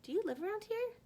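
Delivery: eager greeting. A narrator's reading. Girl greets classmate eagerly outside school, high and eager. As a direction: greet him eagerly, pitched well up. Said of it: "she greeted me eagerly".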